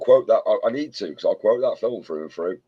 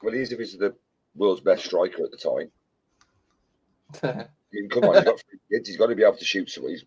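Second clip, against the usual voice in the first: ordinary delivery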